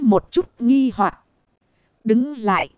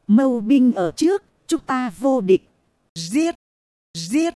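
plain reading voice

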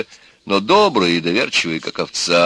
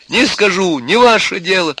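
high, loud angry outburst; tearful shout; 11 semitones higher, 5 dB louder than usual. A narrator's reading. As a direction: yell back at your brother. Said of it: "закричала на него заплаканная Алька". (крик заплаканной сестры)

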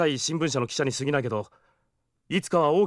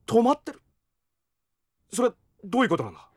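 jealous shock, rushing for an answer; shocked disbelief; 8 semitones higher, faster than usual. Real, not acted. Learns hunter stays at her house.

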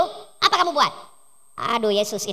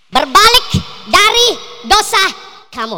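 preaching exhortation; 3 semitones higher, 6 dB louder, slower than usual. Acted. Preacher urging the congregation, slow and loud. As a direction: cry out the call to turn from sin. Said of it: "preacher exhorts congregation".

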